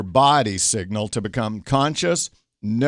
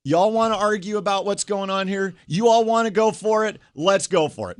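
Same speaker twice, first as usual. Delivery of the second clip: higher, daring taunt; combative inner challenge; 7 semitones higher than usual. Retold or imitated. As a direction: square up to the whole room. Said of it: "I said in my head".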